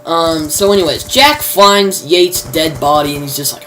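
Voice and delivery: ordinary talk, nothing marked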